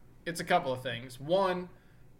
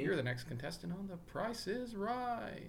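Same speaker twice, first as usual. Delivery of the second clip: game show announcer; 5 semitones higher, 9 dB quieter than usual. Pretend.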